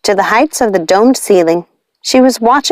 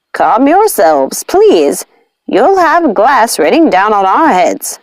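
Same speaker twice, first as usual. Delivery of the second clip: plain reading voice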